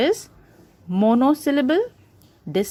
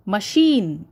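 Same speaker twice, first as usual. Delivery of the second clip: "machine" with short ma, long sheen; stress on second syllable. Shown as a demonstration. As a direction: lean hard on sheen in "machine". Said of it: "Sheen is stressed".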